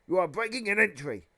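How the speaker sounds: high, loud outraged protest; indignant accusation. Acted. Uncle Vernon accusing the giant of breaking in, indignant. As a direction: accuse the intruder, raising your voice.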